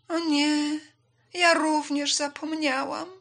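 high, loud, drawn-out lament; dismayed. Acted. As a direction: wail at forgetting. Swallow realises she forgot.

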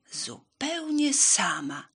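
slow, high, loud „zupełnie sama”; „zupełnie sama” drawn out emphatically; emphatic declaration. A narrator's reading. Hen declares she eats alone.

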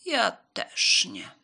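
slow, loud cat refusal; loud, drawn-out storybook cat; called-out refusal. A narrator's reading. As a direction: stretch it out loudly as the cat. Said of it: "zawołał biały kot".

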